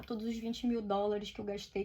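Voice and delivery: normal talking voice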